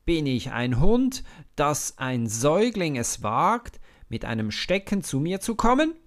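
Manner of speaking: loud mocking yell, climbing high and rushing at the end; furious scornful shout; giant's scream. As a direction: roar with contempt at the little boy. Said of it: "schreit Goliath".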